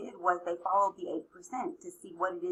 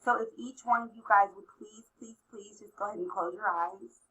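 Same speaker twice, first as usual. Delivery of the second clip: ordinary delivery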